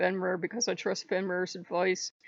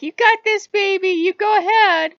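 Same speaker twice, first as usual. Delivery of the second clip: high, loud cheerleader shout; cheering on; 11 semitones higher, 11 dB louder than usual. Retold or imitated. Gods cheering her on.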